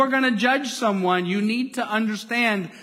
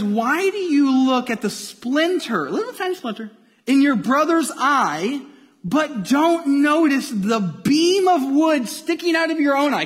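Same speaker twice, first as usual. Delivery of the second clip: vivid dramatic retelling; 5 semitones higher than usual. Shown as a demonstration. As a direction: paint the picture so they can't miss the splinter and the beam. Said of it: "Just picture it. Put it in your head".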